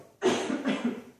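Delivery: a short dry cough. Real, not acted. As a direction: cough to clear the throat. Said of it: "Excuse me, coughing".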